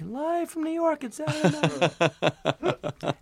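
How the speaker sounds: mock announcer opening the show. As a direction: ham up the show's opening call. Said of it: "and then you hear, like".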